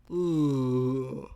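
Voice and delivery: low groaning yawn. Real, not acted. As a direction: yawn out loud. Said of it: "I'm just yawning".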